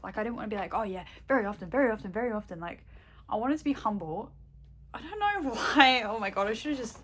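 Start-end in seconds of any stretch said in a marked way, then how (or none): 0.7-2.6 over-the-top eager. Retold or imitated. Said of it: "I don't wanna seem over the top".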